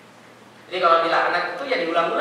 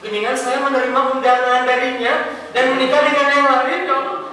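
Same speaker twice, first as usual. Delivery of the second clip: mock tearful prayer; 4 semitones higher, 4 dB louder than usual. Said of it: "tiba-tiba dia nangis kan".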